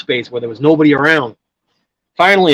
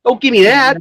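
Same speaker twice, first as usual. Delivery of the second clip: a sharp, higher dismissal; indignant retort; 10 semitones higher than usual. Real, not acted. To a friend, the courier rejects the blame, exasperated. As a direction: wave the objection away, fed up.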